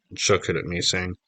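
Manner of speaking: usual speaking voice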